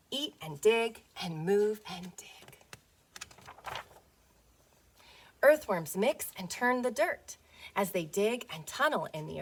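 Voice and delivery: gentle storybook read-aloud; reading to children. Acted. Teacher reading a worm picture book to her class, storybook voice. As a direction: read it as if to little ones on the rug.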